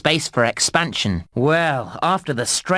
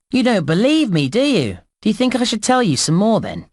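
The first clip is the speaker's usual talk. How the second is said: higher, playful questioning; teasing disbelief; 6 semitones higher than usual. Acted. Game guide to a player who wants more hints, teasing. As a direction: tease the listener with lifted pitch.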